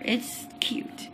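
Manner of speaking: breathy aside, voice dropped right away; whisper. Real, not acted. Vlogger whispering to herself about a baby name. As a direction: whisper it to yourself like a secret.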